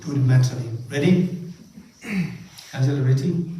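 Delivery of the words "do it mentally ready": usual speaking voice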